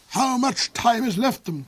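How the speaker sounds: high anxious cry; cried out. Acted. The Duke cries to Hark asking about the time left. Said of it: "cried the Duke".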